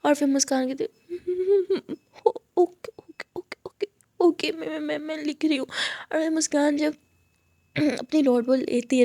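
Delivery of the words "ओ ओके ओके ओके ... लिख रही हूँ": whimpering, broken, near-voiceless okays; scared stammer. Acted. Cowed student agreeing to write, stammering. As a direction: give in fearfully, tripping over each word.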